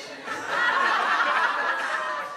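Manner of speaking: loud, high, breathy burst of laughter. Real, not acted. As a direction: let a big laugh rise up. Laughing at her own scheme.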